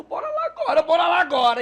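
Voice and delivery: loud, high urging; urgent. Retold or imitated. Wife urges the pastor to go back right now, loud and high. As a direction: push him out the door now.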